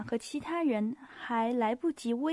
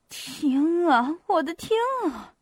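raised, appalled "my God"; horrified exclamation; 5 semitones higher, 4 dB louder, slower than usual. A narrator's reading. Hostess appalled at Pierre's words, horrified. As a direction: exclaim in mounting horror. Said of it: "以低沉而可怖的嗓音说道".

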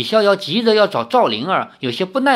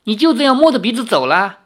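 high, loud taunting outburst; petulant stamping anger; 8 semitones higher, 5 dB louder than usual. Acted. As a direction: fling it after someone walking off. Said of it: "林月如在背后跺着脚说".

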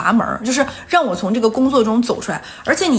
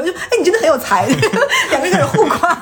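higher, laughing through the praise; 6 semitones higher than usual. Real, not acted. Describing two friends trading compliments, laughing and higher. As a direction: giggle as the friends start praising each other.

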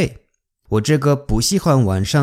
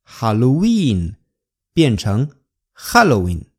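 normal talking voice

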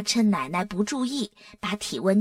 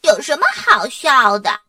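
louder, indignant retort; displeased; 3 semitones higher, 7 dB louder than usual. A narrator's reading. Dora rebukes Arthur for laughing at her, displeased. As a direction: snap crossly at being laughed at. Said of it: "多拉不高兴地说".

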